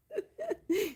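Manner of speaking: high giggling laugh, almost breaking; helpless giggle. Real, not acted. Streamer giggling at her own leap year tease.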